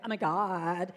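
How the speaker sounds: put-on loud, drawn-out Valley Girl disgust; mock an appalled Valley Girl. Cool schoolgirls reacting to a classmate, mocking Valley Girl. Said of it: "rolling their eyes and going".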